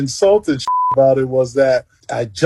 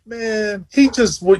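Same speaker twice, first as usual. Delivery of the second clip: usual speaking voice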